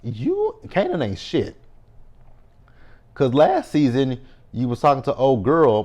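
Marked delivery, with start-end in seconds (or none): 0.0-1.5 scolding Kanan. Retold or imitated. Calling out Kanan on screen, fast and high. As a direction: rush it, then squeak high on "You". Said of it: "I was like".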